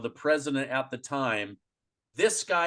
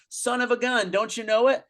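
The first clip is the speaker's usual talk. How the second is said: higher and louder gloating boast; mock Biden boast; 10 semitones higher, 5 dB louder than usual. Imitating Biden gloating to the listener, higher and louder. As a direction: crow it like a smug politician who just won.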